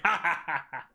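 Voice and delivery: distant laughter from the next room; faint laughing heard through a wall; from another room. Retold or imitated. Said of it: "I just heard this, like".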